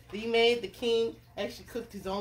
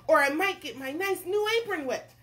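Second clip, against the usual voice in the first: higher, hammed-up worry; nervous cook; 7 semitones higher, 5 dB louder than usual. Cook worries about fishing, acted nervousness. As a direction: fret in a raised voice. Cook lists his fears.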